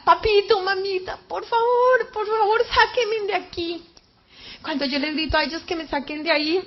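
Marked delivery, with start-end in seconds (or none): 0.0-3.8 high, pleading call to parents; crying out. Retold or imitated. Daughter in the pit begging her parents for rescue, crying out. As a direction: call up for help, pitch raised throughout. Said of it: "Yo empecé a gritar".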